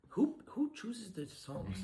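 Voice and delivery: high, slow incredulous question; exasperated disbelief. Real, not acted. Complaining about a far too fast waltz song, exasperated.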